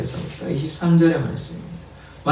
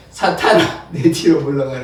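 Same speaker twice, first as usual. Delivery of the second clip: a touch louder stern rebuke, half laughing; stern Jesus; 2 semitones lower, 3 dB louder than usual. Retold or imitated. Preacher quoting Jesus rebuking Peter, mildly stern and louder. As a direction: command Satan away with mild menace. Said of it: "좀 무섭게 얘기해요".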